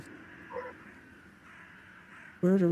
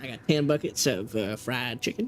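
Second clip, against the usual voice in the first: Texan accent. Shown as a demonstration. Asked for a Texan by a friend, Texan accent. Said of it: "A Texan".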